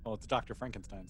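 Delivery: ordinary talk, nothing marked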